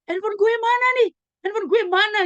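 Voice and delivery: loud, very high-pitched mock shout of a student; acted yelling. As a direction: yell it out like a teen staging a commotion. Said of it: "Lalu dia teriak-teriak".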